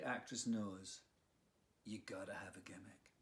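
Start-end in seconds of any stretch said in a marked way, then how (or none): 1.8-3.1 quick soft whisper under the breath; whispered aside. Acted. Narrator confides to the children in a quick whisper. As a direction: let it slip out as a fast secret.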